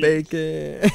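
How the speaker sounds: normal talking voice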